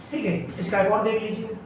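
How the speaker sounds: ordinary talk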